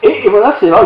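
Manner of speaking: normal talking voice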